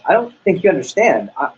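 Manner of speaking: rising irritation, lightly played; a retold, mildly annoyed insistence; irritated. Friend insisting to the salesman, mildly irritated. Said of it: "irritated, said".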